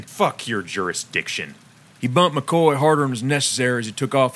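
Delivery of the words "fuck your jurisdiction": dismissive. A narrator's reading. Stanton dismisses the cops.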